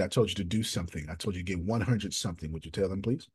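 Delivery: normal talking voice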